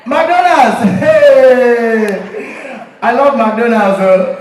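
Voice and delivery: loud, laughing, overexcited directions; over-detailed African man. Acted. African man giving a stranger endless directions, loud and laughing. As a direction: drag out every step with big energy. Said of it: "extremely detailed for no reason".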